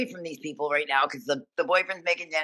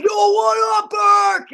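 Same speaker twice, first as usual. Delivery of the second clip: high, loud mock drunken greeting; drunk yell; 7 dB louder, much slower than usual. Drunk boyfriend greets his friend's girlfriend at the door, mock drunk yell. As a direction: holler it like a drunk buddy. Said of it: "this kid's drunk".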